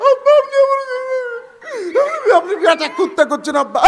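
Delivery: high, loud whine of a hurt son; mock-wail up high at your father. Son complains father called him a dog, high wail.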